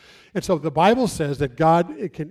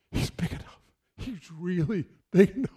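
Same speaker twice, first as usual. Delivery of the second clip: awed whisper; 5 dB quieter than usual. Acted. Listener marvels after pushing off.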